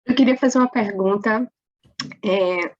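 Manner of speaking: hoarse, husky voice; speaking through a hoarse throat. Real, not acted. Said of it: "desculpa, tô rouca".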